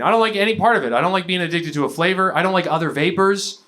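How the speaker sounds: usual speaking voice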